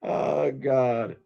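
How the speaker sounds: slow words breaking into laughter; exasperated laughing. Real, not acted. Streamer stalled on a call tells viewers it continues tomorrow, laughing in exasperation. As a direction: laugh it off instead of cursing. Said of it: "I'm laughing 'cause I don't wanna curse".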